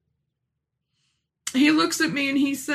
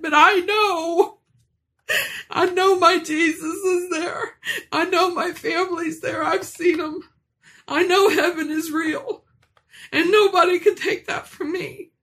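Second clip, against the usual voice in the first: crying out with raised voice and higher pitch; tearful proclamation; 5 semitones higher than usual. Real, not acted. Testifying to a congregation, crying and raised. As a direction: proclaim it through tears, voice climbing.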